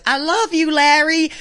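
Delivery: high-pitched mock fangirl call; put-on gushing audience girl; imitated female fan. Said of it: "one of these girls in the audience goes".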